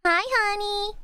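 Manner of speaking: high sugary greeting to a sweetheart; cutesy baby voice. Acted. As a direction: coo it like a baby. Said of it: "how to say it cutely".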